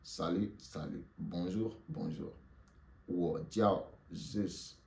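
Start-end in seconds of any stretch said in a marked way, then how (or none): 3.1-4.8 slow and clear; slow model phrase. Shown as a demonstration. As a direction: model it slowly for the class. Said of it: "Répétez après moi". (lentement et clairement)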